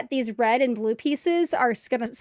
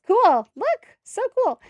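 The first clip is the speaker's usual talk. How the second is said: much higher, slow, gushing cool; delighted squeal; 11 semitones higher, 8 dB louder, much slower than usual. Real, not acted. Streamer shows viewers the build, gushing with delight. Admiring the finished Lego section.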